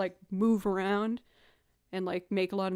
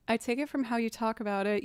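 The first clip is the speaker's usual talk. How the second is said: ordinary delivery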